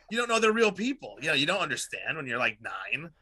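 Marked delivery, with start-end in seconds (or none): none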